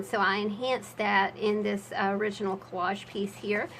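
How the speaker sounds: usual speaking voice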